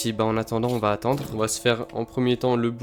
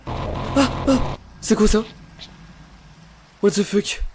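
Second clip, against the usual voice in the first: high frightened outbursts; scared horror game streamer; 9 semitones higher, 5 dB louder than usual. Real, not acted. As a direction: yelp in real panic.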